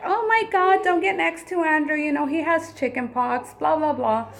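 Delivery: high, taunting playground voice; mocking schoolkids. Mother imitating kids teasing her son, high and mocking. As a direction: mimic the kids teasing, high and sing-song.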